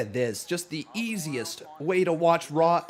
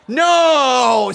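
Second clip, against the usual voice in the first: voicing the toy; 8 semitones higher, 9 dB louder than usual. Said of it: "See, he says no".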